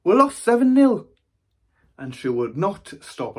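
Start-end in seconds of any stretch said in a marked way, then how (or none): 0.0-1.1 put-on high, loud nine-year-old voice; child's loud reply. Nine-year-old answering nana about the match, high loud voice. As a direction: belt it out as a proud, oblivious kid. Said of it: "I said".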